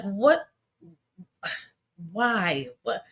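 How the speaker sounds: ordinary talk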